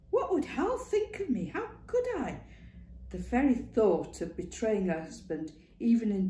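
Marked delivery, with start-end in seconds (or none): 0.1-2.4 much higher, anguished protest; distressed cry. Acted. Clara protesting she'd betray her husband, distressed. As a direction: cry out in dismay.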